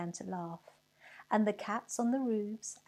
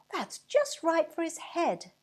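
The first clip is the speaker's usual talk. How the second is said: put-on little boy's voice; 9 semitones higher, 5 dB louder than usual. A narrator's reading. Little boy eyes pudding for snowman.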